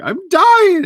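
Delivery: high, loud dramatic wail; hammed-up morning agony; mock dying. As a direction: overplay the suffering. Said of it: "I'm just like".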